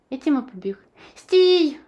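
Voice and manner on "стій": sharp high loud cry; shout. A narrator's reading. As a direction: yell to stop him. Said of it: "скрикнув Расмус".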